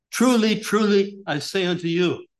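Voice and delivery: each "Truly" called out high and loud; emphatic repetition. Shown as a demonstration. Preacher stressing Christ's words to his flock, loud emphatic call. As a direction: ring out "Truly, truly" like a proclamation. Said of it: "That's an emphasis".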